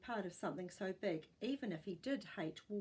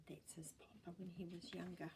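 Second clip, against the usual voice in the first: whisper; 2 semitones lower, 11 dB quieter than usual. Real, not acted. Aside while showing a photo.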